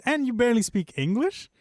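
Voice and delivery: high, loud retold disbelief; incredulous question. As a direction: climb into a baffled question. Retelling own incredulous question to friend.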